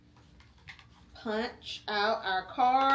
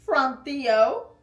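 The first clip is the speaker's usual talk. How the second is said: grandma's voice; 4 semitones higher, 9 dB louder than usual. Acted. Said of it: "his grandma said".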